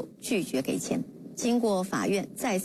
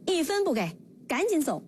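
higher-pitched, firm dismissal; narrated joint refusal; 6 semitones higher, faster than usual. A narrator's reading. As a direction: refuse and send her off, in a storyteller's voice. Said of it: "公婆竟齐声说". (旁白演绎的断然拒绝，音调偏高)

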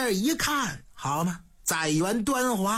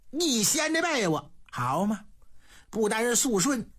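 ordinary delivery, nothing marked

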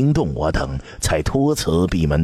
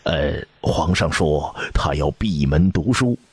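plain reading voice

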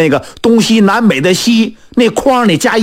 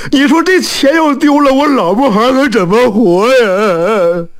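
acted crying; 5 semitones higher than usual. Blacksmith fears for wife and children, crying. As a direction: bawl it out in mock despair. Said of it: "说着说着还哭上了".

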